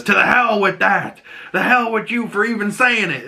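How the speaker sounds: high, shouted outrage, pitched well up; angry outburst. Retold or imitated. Seth shouting down the council members, high angry outburst.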